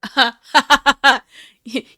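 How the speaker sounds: loud maniacal villain cackle; evil laugh. Pretend. Mock villain gloating at captors.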